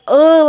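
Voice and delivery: hammed-up ugh of disgust; mock disgusted ugh. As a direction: groan like a grossed-out husband.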